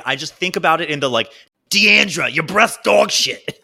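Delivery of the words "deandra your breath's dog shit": higher, slow, drawn-out comic insult; punchy mock outburst, stretched at the end; Danny DeVito. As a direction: imitate the actor hurling an insult. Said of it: "Anytime he reads something or does anything".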